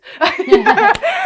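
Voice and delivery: a big, high, loud laugh. Real, not acted. Caught out by her husband, laughing loud and high.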